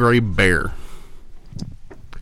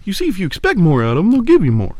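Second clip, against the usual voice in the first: preachy mentor voice; 7 semitones higher, faster than usual. Retold or imitated. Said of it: "quoting the chaplain".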